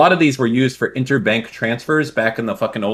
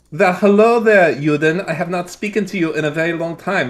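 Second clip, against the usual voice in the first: put-on German accent; 6 semitones higher, 3 dB louder than usual. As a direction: ham up a German accent, drawing out the greeting. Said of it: "talking in such a German accent".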